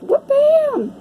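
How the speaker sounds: sudden high whoop-bam; excited whoop. Real, not acted. Reader cheering a card she just laid down, high excited whoop. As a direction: cheer the card onto the table.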